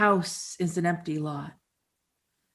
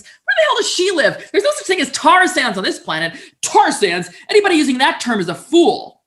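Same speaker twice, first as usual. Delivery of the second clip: much higher, louder hammed-up tirade with a laugh; outraged commenter; 10 semitones higher, 11 dB louder than usual. Mocking a furious commenter's reply about tar sands, loud high mock outrage. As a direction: shriek it like a furious forum poster.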